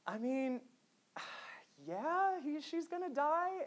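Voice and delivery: high, drawn-out reluctant admission with a sigh. Retold or imitated. Father breaking bad news to his five-year-old, high and reluctant. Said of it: "I kinda had to say".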